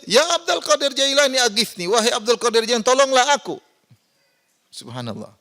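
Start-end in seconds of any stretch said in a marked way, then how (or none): 0.1-3.6 much higher, pleading cry for help; desperate plea. Retold or imitated. Preacher imitates a worshipper in trouble crying out much higher to a saint. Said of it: "Dalam kondisi sulit".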